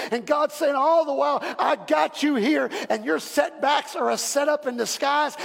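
loud, rising preacher's cry; full-force preaching. Acted. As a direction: preach it out to the back row.

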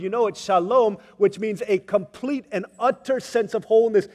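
loud, ringing sermon build; fiery preaching. Acted. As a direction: preach it out with passion. Preaching at full force.

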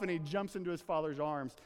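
normal talking voice

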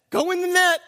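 commanding call. Acted. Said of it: "He calls the fish and says".

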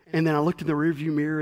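normal talking voice

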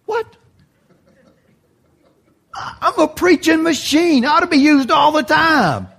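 mock indignant. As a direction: play offended, pitch up high. Indignant at being sidelined.

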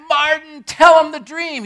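high, loud, slow shout; screamed call. Retold or imitated. Singer urging Martin to tell the dream, high and loud. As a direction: cry out to urge him on. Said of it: "that voice was a trusted voice".

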